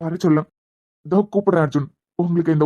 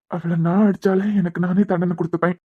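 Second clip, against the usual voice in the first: whispered. Acted. Hurt husband speaks of wife.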